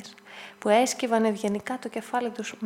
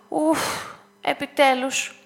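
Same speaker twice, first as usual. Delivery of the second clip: breathless sigh; 2 semitones higher, 4 dB louder, slower than usual. A narrator's reading. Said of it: "αναστέναξε λαχανιασμένη η Άννα".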